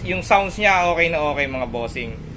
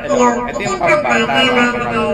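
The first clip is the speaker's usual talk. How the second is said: high chipmunk pitch shift; pitched up like a kid; child voice changer; 8 semitones higher, 3 dB louder than usual. Shown as a demonstration.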